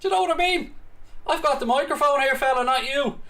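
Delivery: high-pitched, defiant comeback; indignant retort. Real, not acted. Streamer answering a viewer who said stop commentating, indignant. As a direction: put the heckler in his place, pitch climbing.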